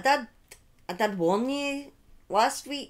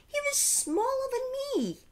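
pitched way up in disbelief; incredulous emphasis. Real, not acted. Telling listeners the accused boy was tiny, high incredulous emphasis. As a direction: stress how tiny he was, astonished.

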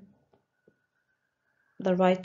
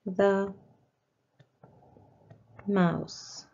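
word by word with long pauses; spaced-out dictation, one word at a time; dictation pace; much slower than usual. Shown as a demonstration. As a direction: dictate slowly so children can write. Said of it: "So let's write it down".

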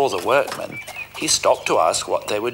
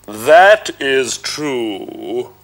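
slow, louder booming ogre; proud ogre; 2 semitones higher, 7 dB louder, much slower than usual. Acted. Ogre pleased with his fame, slow loud boom. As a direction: savour the boast slowly.

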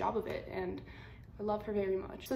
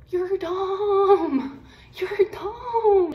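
shrill, high, loud mock shriek of a shocked mom; 12 semitones higher, 12 dB louder, much slower than usual. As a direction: screech it up high like a mother in shock. Mom's reaction voiced very high, loud.

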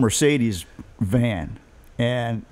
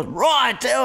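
British driver; 11 semitones higher than usual. Retold or imitated. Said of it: "My dad's like".

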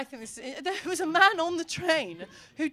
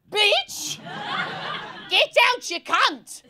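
high-pitched yelled catcall, punched word by word; mock heckling; 8 semitones higher, much slower than usual. Man leans out of his car at a passer-by, mock heckling. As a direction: yell it out of a car window.

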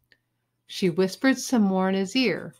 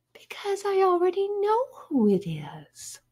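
high, breathy near-whisper; soft singsong secret; coy confiding; 12 semitones higher, 4 dB quieter than usual. A narrator's reading. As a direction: tease softly in a high girlish voice. Judy confiding coyly to Joey.

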